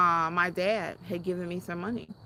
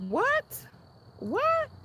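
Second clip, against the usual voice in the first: high, rising incredulous what; a stunned double take. Retold or imitated. Imagining being cut off by her father, stunned. Mock outrage at imagined scenario.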